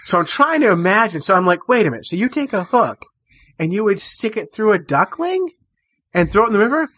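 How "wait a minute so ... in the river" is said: higher, building horrified question; retold outrage at the fishing trick; appalled disbelief. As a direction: spell out the awful idea in disbelief. Horrified question about duckling bait.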